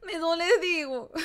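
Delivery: laugh-sob on the words; half-laughing fake hurt; laughing through mock pain. Real, not acted. Streamer laughs at being ignored, laugh-crying.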